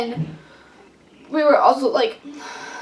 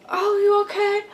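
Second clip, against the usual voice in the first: mock concerned friend; 7 semitones higher, 4 dB quieter than usual. Friend Ashley voiced in story.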